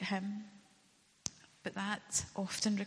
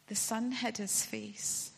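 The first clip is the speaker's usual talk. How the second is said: ordinary delivery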